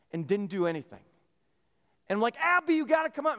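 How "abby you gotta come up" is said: raised, higher dad voice; calling down. Retold or imitated. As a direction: call out to a child down the slope.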